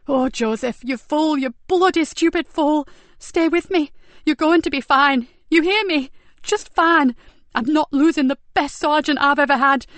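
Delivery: frantic high-pitched begging; loud, panicked, pleading; desperate pleading. Acted. Nicky pleading over dying sergeant.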